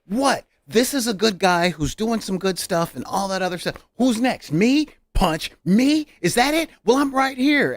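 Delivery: high, loud mock Captain America challenge; hammed-up Cap daring him to throw a punch; Captain America fed up. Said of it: "showed up going".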